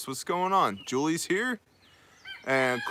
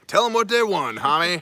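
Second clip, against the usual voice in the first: teasing hype; 6 semitones higher, 5 dB louder than usual. Real, not acted. Hypes up his wife, teasing.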